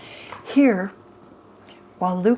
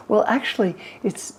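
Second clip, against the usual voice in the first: normal talking voice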